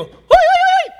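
shepherd in Israel hollering to his sheep, high and loud; ridiculous shepherd call. Retold or imitated. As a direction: yodel it out like a shepherd calling his flock. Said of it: "And they go".

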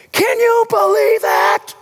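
preacher to the congregation, amazed disbelief. Real, not acted. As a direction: marvel out loud at the wonder of it. Preacher marvels at grace.

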